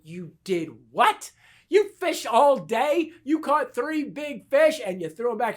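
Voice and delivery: retold disbelief, slow then high and loud; incredulous outburst. To a friend who threw back his fish, incredulous. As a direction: build from drawn-out shock to loud protest.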